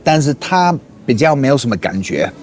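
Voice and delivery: ordinary talk, nothing marked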